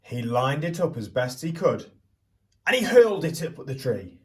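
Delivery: put-on lively storyteller voice for children; picture book read-aloud. A narrator's reading. Teacher reading Stuck to the class, storytelling voice.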